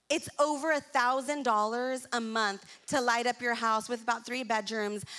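ordinary talk, nothing marked

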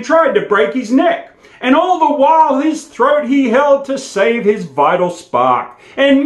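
lively bush ballad recital, rising into high loud drama; poem recitation. Acted. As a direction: recite it like a campfire yarn, building to the fight.

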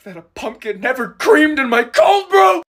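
high loud shout; outraged shout. Acted. Asking the barista about pumpkin cream, loud outrage. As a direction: shriek the accusation. Mock betrayal.

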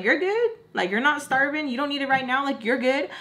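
high, lilting brush-off; mock dismissive. Borrower putting off giving money back, mock dismissive. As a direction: mimic a borrower waving away the debt.

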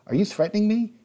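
high, loud, incredulous challenge; indignant outburst. Retold or imitated. Boss Hogg to Victor after a threat, indignant outburst. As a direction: rise sharply in outrage.

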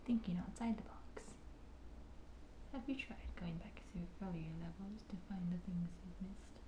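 reading the hint in a whisper; breathy whispered read-out. Real, not acted. Reading game hints softly.

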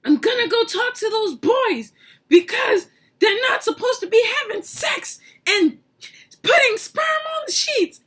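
high, loud furious threat; grandma storming off, shouting high; mad grandma. Retold or imitated. Grandma vowing to confront the boys, high angry shout. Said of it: "she gets mad".

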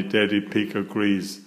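usual speaking voice

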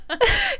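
high squealing laugh; shrieking laugh. Real, not acted. Big laugh at joke.

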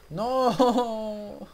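high, loud cry of dismay; sudden shocked protest. Real, not acted. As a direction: yelp it out in disbelief. Villagers trapped in the glass.

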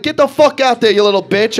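loud, high furious bark; yelling Italian dad. Acted. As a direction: bawl out the kid, full volume. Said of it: "just getting yelled at by two Italian dads".

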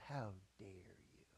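slow, unvoiced offended whisper; imitating a woman's indignant whisper; hissed how dare you. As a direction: hiss it as an insulted con woman. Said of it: "she goes".

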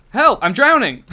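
mock panic. Character sinking in game water.

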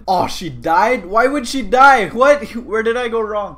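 high, loud disbelief; shocked dismay. Real, not acted. Player whose captive just died, shocked dismay. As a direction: cry out in baffled protest. Bounty dies unexpectedly.

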